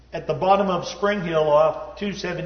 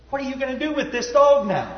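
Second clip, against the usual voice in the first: a hint of an incredulous wife, pitched much higher; wife's incredulous question; 9 semitones higher, 3 dB louder than usual. Husband retells his wife, higher, incredulous at the wrong dog. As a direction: lightly voice the wife's disbelief.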